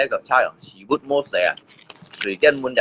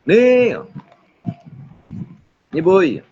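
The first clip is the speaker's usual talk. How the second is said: raised, high-pitched calling; 8 semitones higher, slower than usual. Real, not acted. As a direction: call out high to get attention. Repeated calls to someone.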